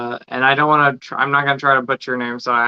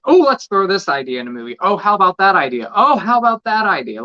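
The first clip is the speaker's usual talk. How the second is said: quick, rising excited pitches; rapid-fire mock enthusiasm, climbing higher; mock brainstorming; 6 semitones higher, 4 dB louder, faster than usual. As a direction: toss out ideas in mock excitement. Mocks filmmakers tossing out ideas.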